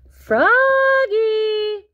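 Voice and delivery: high, loud, long-held call; mom's drawn-out summons. Acted. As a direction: holler the name from afar, sustained. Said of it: "called his mom".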